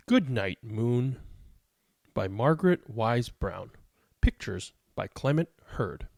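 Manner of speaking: gentle bedtime story read, slow and clear; reading to children. Acted. Reads picture book aloud.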